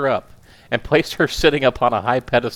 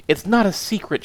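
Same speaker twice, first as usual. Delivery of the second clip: high-pitched indignant cry; 11 semitones higher, 3 dB louder than usual. Acted. Wife cries out at her husband, indignant. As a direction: fling it back at him. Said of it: "she cried".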